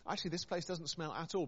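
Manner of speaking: usual speaking voice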